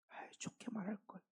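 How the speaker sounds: Paul whispering to himself that he should have spoken gently; regretful whisper. Acted. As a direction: breathe it out like a private confession. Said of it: "몇 번이고 후회했다는 거예요".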